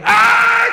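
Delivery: loud, high-pitched mock scream; acted scream. Man leaping across the room, loud acted scream. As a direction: scream out at full volume. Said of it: "the guy came screaming".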